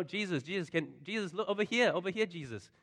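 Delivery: high pleading cries over a crowd; calling out. Retold or imitated. Townspeople clamouring for Jesus' attention, calling high. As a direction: wave and call for attention. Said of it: "all these people calling out".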